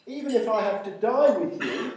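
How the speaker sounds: lifted, insistent declaration; emphatic. Acted. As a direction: press the pledge home forcefully.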